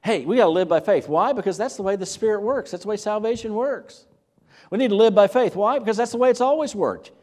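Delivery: ramping up. Shown as a demonstration. Preacher voicing Paul's argument, ramping up loudly. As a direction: build the case with rising force. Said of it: "he keeps ramping up".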